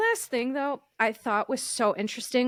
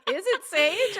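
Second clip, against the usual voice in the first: much higher, teasing airy voice; mock airy reality star; 9 semitones higher than usual. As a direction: lift it way up like a ditzy question. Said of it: "She's like".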